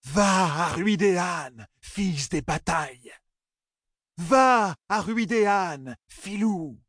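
high, very slow, steam-like wail; hissing cry. A narrator's reading. Three oracles crying together at Matt, hissing cry. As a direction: stretch each word out like escaping steam. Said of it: "crièrent d'une voix chuintante comme de la vapeur".

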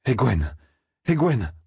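calling out. A narrator's reading. Young man seeks the girl mid-battle, calling out. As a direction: call for her, rising on the repeat.

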